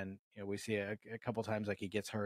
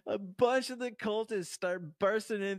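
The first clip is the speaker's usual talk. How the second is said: laughing; 10 semitones higher, 7 dB louder than usual. Real, not acted. Laughing through telling the scene.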